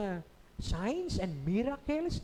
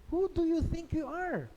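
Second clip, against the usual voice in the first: higher, scoffing retort; sarcastic challenge; 8 semitones higher, 3 dB louder than usual. Retold or imitated. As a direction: sneer it back at him. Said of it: "pa-sarcastic ang dating ng mga tanong".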